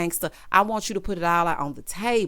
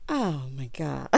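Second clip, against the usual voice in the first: ordinary talk, nothing marked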